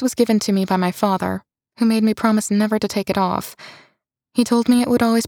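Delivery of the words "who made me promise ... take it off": ordinary delivery, nothing marked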